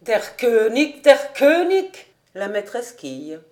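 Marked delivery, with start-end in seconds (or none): 0.0-1.0 loud, slow German cry; rising shout of Der König; exclaiming. A narrator's reading. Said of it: "s'écriait". 1.0-2.1 much louder and higher triumphant yell; full shout. A narrator's reading. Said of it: "s'écriait".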